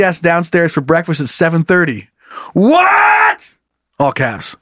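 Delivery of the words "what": outraged shout. Acted. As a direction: cry out in disbelief. Reading all-caps outrage.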